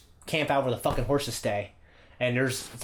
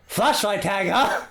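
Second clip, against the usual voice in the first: sarcastic; 6 semitones higher, 4 dB louder, slower than usual. Retold or imitated. Dad sarcastic disbelief.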